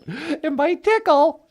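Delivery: high teasing lady voice. Pretend. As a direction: tease him playfully, drawn out. Natasha teasing.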